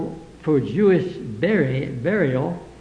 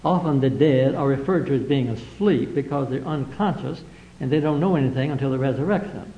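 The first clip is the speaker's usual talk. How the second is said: ordinary talk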